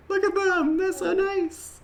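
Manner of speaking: very high-pitched gushing; cutesy cooing. Real, not acted. Player admiring the little painted figures, high cooing. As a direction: coo over them way up high.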